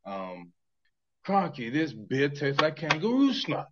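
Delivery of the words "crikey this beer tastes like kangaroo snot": slow, put-on Australian accent; broad mock Australian, drawn out. Host asked for Australia, slow mock Australian accent.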